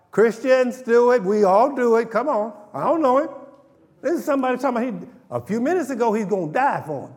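high, loud fervent preaching; preaching at full force. Acted. Preacher pressing the point to the church, full force. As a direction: drive it home to the congregation.

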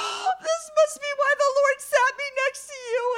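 gasp, then loud high squeal; hammed-up high-pitched revelation; awestruck realization. Woman realizing why they were seated together, awestruck.

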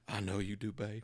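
calm her down gently and quietly; really calm. Retold or imitated. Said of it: "he says all really calm".

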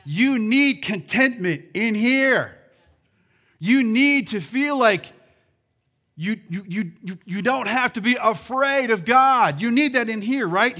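loud, driving sermon delivery; full-force preaching. Real, not acted. Preacher pressing his church on what they need, loud and driving. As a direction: hammer it home to the congregation.

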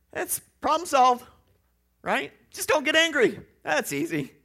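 high, loud mock-cheerful advice; sarcastic. Real, not acted. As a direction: oversell the easy fix, sarcastically.

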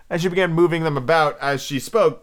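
ordinary delivery

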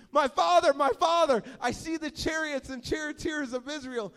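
king wailing to the dying prophet in terror; terrified cry. Acted. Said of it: "He's terrified".

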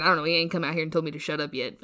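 ordinary delivery

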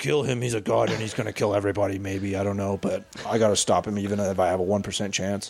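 lightly voiced, rambling hero monologue; mock movie hero. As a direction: parody of an agonizing superhero.

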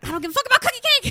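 loud, high, rapid-fire outburst; furious yell. Retold or imitated. Maury rejects the cookie cake, furious yell. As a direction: explode fast at top volume.

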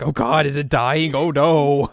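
imitated frantic cry; mock panic. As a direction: panic over a sick pet, hammed up. Azusa panics at cat puking.

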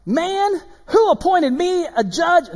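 high, loud, drawn-out mock indignation; playful hammed-up scripture reading; exaggerated inflection. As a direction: overplay the indignant question for fun. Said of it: "I'm just kidding. I'm inflecting".

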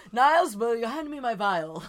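put-on glamorous old Hollywood star voice; transatlantic accent. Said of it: "That transatlantic accent".

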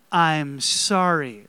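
slow, loud, drawn-out model apology; leading group repetition. Shown as a demonstration. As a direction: say it big and slow for a crowd to join. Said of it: "Let's try it again".

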